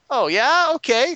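high, loud, drawn-up response; mock credulous reply. Players hearing the owner's excuse, exaggerated acceptance. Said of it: "And they're like".

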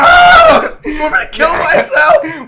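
shrieking high and loud, laughing; mock rage shout. Friend mocks cohost yelling at the video game, shrieking. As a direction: parody a gamer losing it at a boss.

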